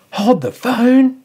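high, slow, drawn-out mock alarm; hammed-up slow gasp of disbelief; mock shocked newsreader. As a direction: stop everything like breaking news. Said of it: "that little fake segment I did there".

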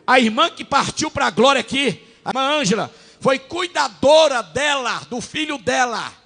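fervent pulpit shout; preaching loud. Real, not acted. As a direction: drive it home to the congregation. Preaching at full force.

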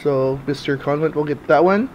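ordinary delivery

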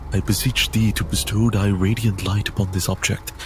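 ordinary talk

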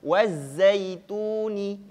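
teacher reads the example aloud first, chanting; Quran recitation demo. Shown as a demonstration. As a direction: recite wazaituni as in the Quran, long and stretched. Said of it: "Ustadz bacakan terlebih dahulu".

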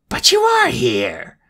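slow, high furious roar. Acted. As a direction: roar it out in disbelief. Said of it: "roared Spiro".

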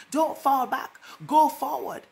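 loud, high preacher's call; loud, rising commands from the pulpit; rousing exhortation. Real, not acted. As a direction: drive the congregation forward.

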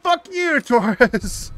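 high, loud curse; angry shout. Real, not acted. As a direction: yell it at the partner. Cursing AI partner.

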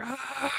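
stressed-out squeal, played for laughs; mock exasperated scream. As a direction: let out a high mock wail of frustration. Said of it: "And I was like".

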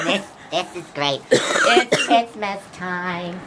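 deep gas voice. Real, not acted. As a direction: drag the words out low and warped. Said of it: "I have a fucked up voice".

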